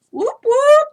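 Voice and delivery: high loud party whoop; real high excited whoop. Real, not acted. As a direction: cheer like a hype chant. Celebrating start of holiday.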